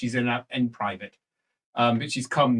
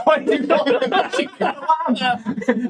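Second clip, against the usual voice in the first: laughing refusal; 9 semitones higher, 5 dB louder than usual. Acted. NPC answers, laughing.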